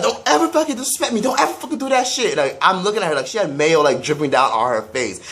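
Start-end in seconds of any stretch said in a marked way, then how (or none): 0.0-2.4 high, fast mocking voice of the angry sister; mock sister yelling. Mimicking the sister who got pied, high and fast. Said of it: "she was like".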